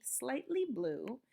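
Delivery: ordinary delivery, nothing marked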